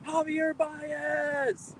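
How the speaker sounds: high, drawn-out fan holler; imitated yell. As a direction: imitate someone yelling across a lot, stretched out. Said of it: "was yelling at the lot".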